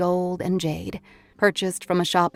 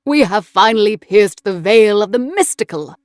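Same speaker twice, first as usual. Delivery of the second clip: loud, grand proclamation; triumphant occult philosopher; 3 semitones higher, 9 dB louder than usual. A narrator's reading. John Dee announces piercing the mystical veil, loud and triumphant. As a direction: declaim it with soaring theatrical force.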